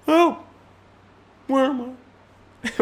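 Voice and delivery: confused mime. Acted. Mime lost, high voice.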